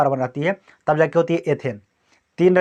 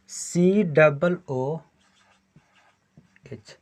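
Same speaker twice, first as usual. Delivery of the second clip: slow dictation; much slower than usual. Shown as a demonstration. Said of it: "teacher dictates formula slowly".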